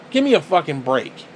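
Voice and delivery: ordinary talk, nothing marked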